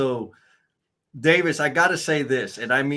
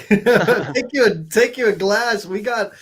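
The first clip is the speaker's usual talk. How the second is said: laughing through the words, pitched up; 9 semitones higher, 8 dB louder than usual. Real, not acted. Host laughing as he toasts the guest, real laughter. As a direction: laugh it out while talking.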